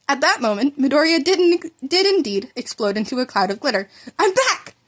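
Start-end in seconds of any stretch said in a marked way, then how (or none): 4.2-4.8 high bright cry; cheerful announcement. Acted. Revived boy greeting the shocked hero, high excited cry. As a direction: pop up and call out happily.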